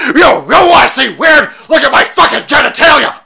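high, loud put-on yell; mock shouting character. Sculptor yelling at his partner, high pitched shout. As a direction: bellow it like an unhinged sculptor.

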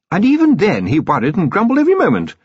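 high, emphatic voice from the doorway; commander's wife, chiding. Acted. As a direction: tease your husband in a high voice.

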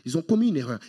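ordinary talk

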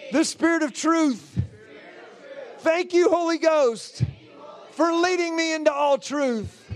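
slow, projected prayer in short phrases; leading a prayer. Real, not acted. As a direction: declare it phrase by phrase for the congregation to repeat. Leads congregation in repeat-after-me prayer.

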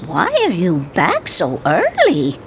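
ordinary delivery, nothing marked

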